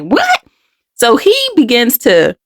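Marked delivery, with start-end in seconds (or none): 0.0-0.4 high, bewildered little "What?"; a confused child's startled question; retold shocked "What?". Girl reacting to the man, in a high bewildered voice. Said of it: "I'm like".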